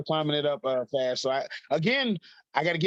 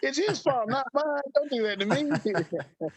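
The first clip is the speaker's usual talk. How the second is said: high comic protest, slowing at the end; laughing mock plea; 10 semitones higher, slower than usual. Son tells mom to blame the commentator, mock pleading. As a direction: shift the blame in a high begging voice.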